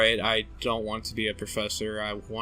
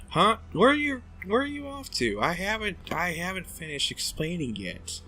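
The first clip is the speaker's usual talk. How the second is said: high indignant voice; 8 semitones higher than usual. Acted. Indignant character, big pitch jump.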